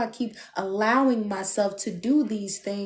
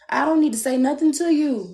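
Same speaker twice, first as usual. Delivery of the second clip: ordinary talk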